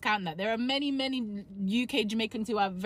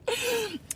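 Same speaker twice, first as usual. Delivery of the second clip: laugh; 12 semitones higher, 5 dB quieter than usual. Real, not acted. Laughs at her own joke.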